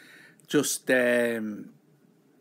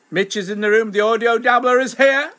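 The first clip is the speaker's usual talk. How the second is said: high, rising emcee-style proclamation; excited loud announcement; 8 semitones higher, 9 dB louder than usual. Real, not acted. Streamer announces a regular joining, loud excited proclamation. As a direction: herald the guest's arrival at full voice.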